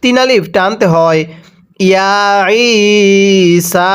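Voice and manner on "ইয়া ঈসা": slow, drawn-out melodic chant; Quran recitation. Shown as a demonstration. Teacher chanting the verse for students, slow melodic recitation. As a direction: stretch each vowel in a slow recitation tune.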